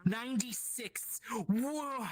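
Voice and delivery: high, slow, awestruck; overwhelmed. Acted. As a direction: gasp at the impossible shape. GM overwhelmed by vision.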